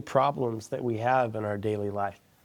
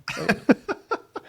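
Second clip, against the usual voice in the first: short laugh; 9 semitones higher than usual. Real, not acted. Laughing about not caring anymore.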